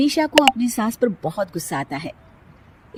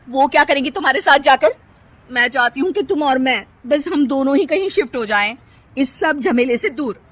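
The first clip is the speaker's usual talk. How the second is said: higher, coaxing tone; pleading wife; 6 semitones higher, 5 dB louder than usual. Acted. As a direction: win the husband over. Kirti persuades husband. (पति को मनाती पत्नी)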